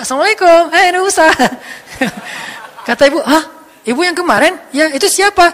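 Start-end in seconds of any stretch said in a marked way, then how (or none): 0.0-1.3 loud, high, cheery hail; hammed-up excited greeting; spirited greeting. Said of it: "udah semangat sekarang". 3.2-5.5 loud, high double take; hammed-up startled questions; astonished questioning. Acts out the surprised prophet questioning.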